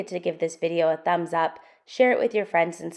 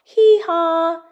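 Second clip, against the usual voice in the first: loud, high donkey hee-haw; big braying hee-haw for the kids to copy; 11 semitones higher, 9 dB louder than usual. Shown as a demonstration. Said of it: "join in and shout out".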